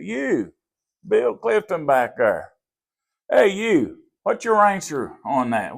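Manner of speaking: single him out from the front of the room; calling out across the class. Retold or imitated.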